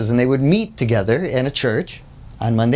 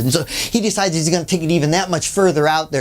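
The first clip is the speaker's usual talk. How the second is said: ordinary talk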